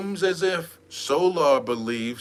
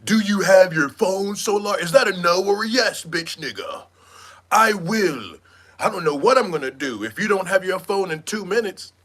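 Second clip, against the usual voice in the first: louder, higher mock demand; mock angry demand; 3 semitones higher, 4 dB louder than usual. Imitating Jaguar yelling at Solar about his phone. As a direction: bark the demand in a put-on voice. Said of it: "Jaguar demanding phone".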